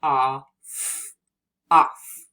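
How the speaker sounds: "ah, f" segmented, then "off" said firmly and loud; sounding out off. Shown as a demonstration. Teacher sounding out a word for young readers. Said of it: "beginning of the word off".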